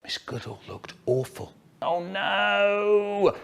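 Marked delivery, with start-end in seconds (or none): 1.8-3.4 high, long wail of dismay; dismayed cry. A narrator's reading. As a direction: wail it in comic horror. Acted dismay of Miss Goodall.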